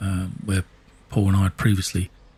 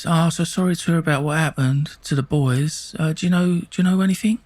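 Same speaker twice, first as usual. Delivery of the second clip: higher, oily fake-sympathy voice; sneaky Jimmy; 7 semitones higher than usual. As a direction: play him as a creepy snake fishing for news. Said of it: "whether he talked like that or not, that's how I see him".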